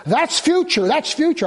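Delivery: high, insistent retold cut-in; mock interrupting. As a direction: imitate him blurting it out over you. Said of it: "he interrupted me".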